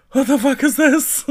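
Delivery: high, loud shriek breaking into laughter; incredulous outburst. Retold or imitated. Coming out to show friends the burnt patches, incredulous. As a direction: wail it in comic outrage.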